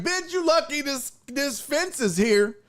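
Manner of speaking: high mock tough-guy taunt. Streamer mimics a tough guy taunting, high voice. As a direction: act tough behind the fence. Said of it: "You be like".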